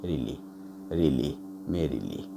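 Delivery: "Relief" low and soft, no weight on either syllable; unstressed syllables. Shown as a demonstration. Teacher showing the unstressed part, low soft relief. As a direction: drop relief low and light. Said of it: "दो unstress होता है".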